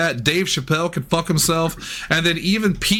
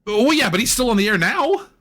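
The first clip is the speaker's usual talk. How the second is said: heated ranting; 5 semitones higher than usual. Real, not acted. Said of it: "I didn't mean to yell at you".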